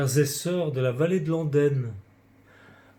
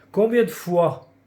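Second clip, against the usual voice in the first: priest in confessional; 3 semitones higher, 5 dB louder than usual. A narrator's reading. Priest asking a boy to count his sins, loud questioning. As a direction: demand it firmly, as the confessor. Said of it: "demanda l'homme en noir".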